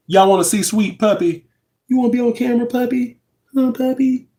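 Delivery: high, sing-song pet voice. Real, not acted. Cooing at her sweet puppy in baby talk. Talking to her dog on camera.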